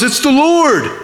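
high joyful call across the water; excited yell. Retold or imitated. John calling out from the boat to Peter, excited shout. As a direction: shout it out as you spot him on the shore. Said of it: "And John yells".

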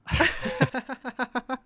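high delighted laugh; real burst of laughter. Real, not acted. Laughing at the fainting story.

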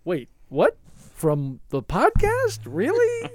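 high, loud, disbelieving; stunned disbelief. Retold or imitated. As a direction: react in total disbelief. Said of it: "to watch Irk's face, like".

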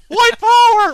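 sudden high, loud joking yell; mock shout. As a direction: blurt it out as a loud gag. Host's joking provocative outburst.

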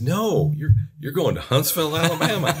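high, laughing voice as the officials say it; quoting them with a chuckle; a mock official announcing the posting. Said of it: "They said".

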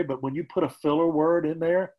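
usual speaking voice

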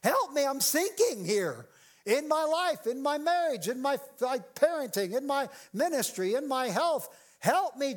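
high, acted plea for rescue; calling out for help. Preacher voicing a believer in trouble, pleading call for help. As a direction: call out urgently, piling up each trouble. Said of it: "probably called out".

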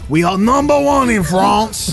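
slow, hammed-up French boast; worst French accent. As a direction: proclaim it in terrible mock French. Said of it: "This is the worst French accent ever".

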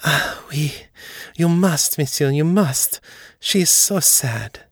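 high French maid voice, moved and urgent; delighted French maid. A narrator's reading. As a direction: plead for the mistress as her French maid. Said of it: "a little moan of delight".

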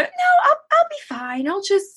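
high-voiced dismissive mom; imitating stubborn mother. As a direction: wave off help in Mom's high voice. Voices mother refusing care.

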